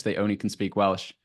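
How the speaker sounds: usual speaking voice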